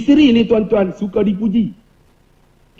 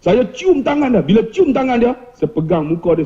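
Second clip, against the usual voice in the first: normal talking voice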